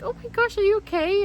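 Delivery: high-pitched imitation of a worried nurse; high voice, fussing over someone upset; concerned nurse. Nurse to crying patient, high concerned imitation.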